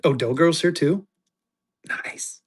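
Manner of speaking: ordinary talk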